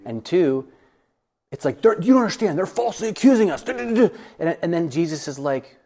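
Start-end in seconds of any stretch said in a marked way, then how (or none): 1.8-4.1 high, loud indignant protest, dissolving into babble; mock a frantic whine of grievance; worked-up complaint. Recalling himself worked up over false accusations, high mock indignation. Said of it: "I got myself so worked up".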